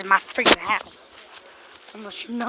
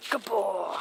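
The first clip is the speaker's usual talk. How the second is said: soft praise; 3 semitones lower, 9 dB quieter than usual. Real, not acted. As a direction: murmur praise to the cat. Praising cat softly.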